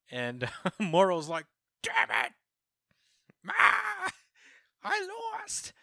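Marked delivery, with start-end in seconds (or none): 1.8-5.7 high, wailing tantrum of defeat; shrieking mock rage, voice shooting up; mock furious. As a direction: scream it like a hammed-up sore loser. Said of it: "He's so mad".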